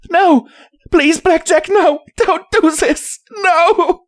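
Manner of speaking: high, desperate filly pleading; screaming. A narrator's reading. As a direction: beg in a child's shriek, not at full volume. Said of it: "she screamed, tearing at me with her cries".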